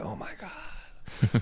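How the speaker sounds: hushed, slow whisper of awe; awed whisper. Retold or imitated. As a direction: breathe it like seeing a miracle. Awestruck at high concert performer.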